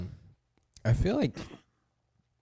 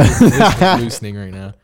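laughing; 3 semitones higher, 13 dB louder than usual. Real, not acted. Laughing at mic stand.

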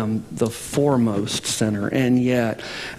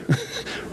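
short laugh; 7 dB quieter than usual. Real, not acted. Pastor laughs at own joke.

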